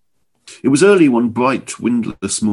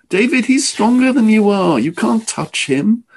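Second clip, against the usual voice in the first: urgent pleading; 12 semitones higher, 3 dB louder than usual. A narrator's reading. Kit's pleading warning.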